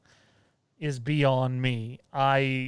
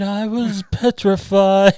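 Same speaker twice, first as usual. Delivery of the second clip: laughing; 6 semitones higher, 9 dB louder, slower than usual. Pretend. Mock horror line.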